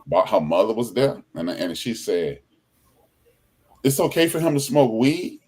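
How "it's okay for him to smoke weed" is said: mock disbelief. Retelling the mother's outraged question.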